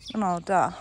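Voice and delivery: ordinary talk, nothing marked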